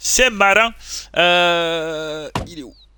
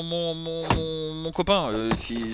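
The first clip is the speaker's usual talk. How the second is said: normal talking voice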